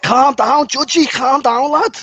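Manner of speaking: high, loud, drawn-out Scouse shout; hammed-up Scouse touchline yell; bad Scouse accent. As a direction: imitate a Scouse manager shouting from the sideline. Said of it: "That's a really bad Scouse accent".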